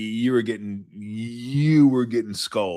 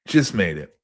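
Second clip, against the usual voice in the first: normal talking voice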